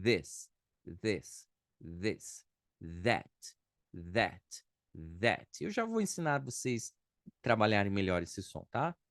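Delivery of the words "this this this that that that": "this" and "that" with a soft voiced th; correct th drill. Shown as a demonstration. Teacher drilling correct th pronunciation for students. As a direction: repeat "this" and "that", tongue between teeth. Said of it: "o correto seria".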